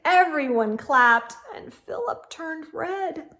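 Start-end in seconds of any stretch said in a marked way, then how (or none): none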